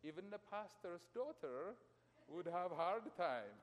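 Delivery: usual speaking voice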